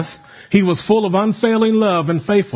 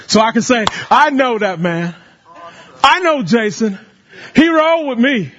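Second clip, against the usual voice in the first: full-force preaching; 7 semitones higher than usual. Acted. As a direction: build the sermon to a shout.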